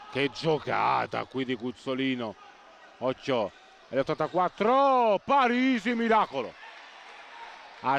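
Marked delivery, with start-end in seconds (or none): 3.9-6.5 high, loud burst of excitement; excited commentary. Real, not acted. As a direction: shout out the last-second save. Late save, commentator exclaims.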